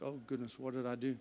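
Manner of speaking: mock worried mutter. CEO retells his own worried reaction.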